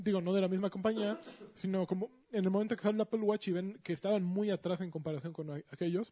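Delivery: talking with mouth full; words round a bite of food. Real, not acted. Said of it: "hablando con la boca llena".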